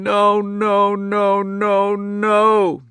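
put-on high, loud, sustained child's wail; keen the word over and over. A narrator's reading. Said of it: "Charlie was saying".

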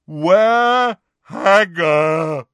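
slow, loud giant roar. A narrator's reading. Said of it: "roared Grawp".

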